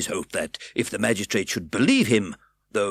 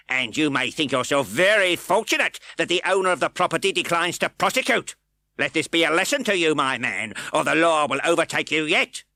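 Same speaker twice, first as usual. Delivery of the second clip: high, loud pompous lecture; pompous magistrate; 9 semitones higher, 6 dB louder than usual. A narrator's reading. Fang lecturing.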